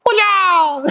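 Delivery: high laughing kiai yell; karate cry. Pretend. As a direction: shout it like a playful karate chop. Mock karate punch cry.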